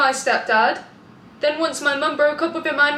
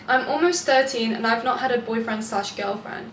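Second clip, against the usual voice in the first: normal talking voice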